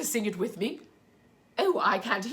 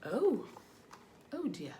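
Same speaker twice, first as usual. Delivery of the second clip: put-on reading to children; 3 semitones higher, slower than usual. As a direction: read gently to little ones. Reads picture book to children.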